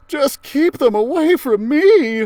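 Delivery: put-on high, slow, pinched kid voice; whiny Arnold. Librarian voicing scared Arnold, high and slow. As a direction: squeak it out like a nervous kid.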